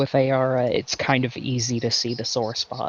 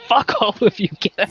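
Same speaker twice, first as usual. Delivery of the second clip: high, loud mock outburst; mock angry dismissal; 8 semitones higher, 5 dB louder than usual. Snarky goddess dismissing the whole party, mock angry. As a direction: throw them all out, raised and emphatic. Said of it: "She's just like".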